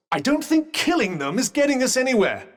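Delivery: high, insistent combat instructions; urgent battle coaching. Acted. Companion directing Frey to smash the lamp, urgent. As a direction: press the point urgently over a fight. Urging companion during a fight.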